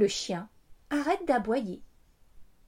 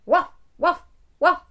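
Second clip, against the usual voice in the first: loud barking woof; dog barking; 5 semitones higher, 9 dB louder than usual. A narrator's reading. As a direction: bark like a dog.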